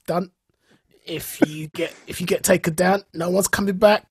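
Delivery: usual speaking voice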